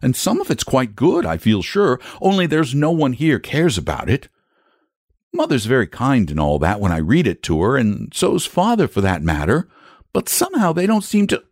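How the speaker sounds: put-on high-pitched boy, confiding; earnest boy. A narrator's reading. Boy figure in a story.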